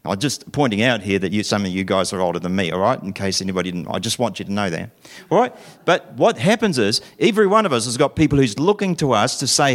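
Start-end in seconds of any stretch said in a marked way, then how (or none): none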